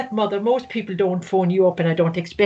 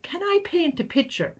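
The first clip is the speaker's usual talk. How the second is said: put-on child's question; 9 semitones higher than usual. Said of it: "he said".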